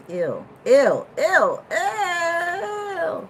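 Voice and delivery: squealing ew of disgust; grossed-out high whine. Real, not acted. Disgusted sounds, crying pitch.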